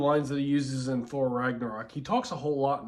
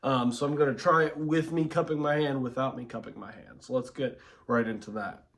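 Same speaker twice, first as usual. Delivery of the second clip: normal talking voice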